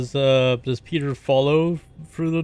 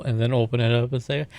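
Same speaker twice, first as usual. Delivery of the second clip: normal talking voice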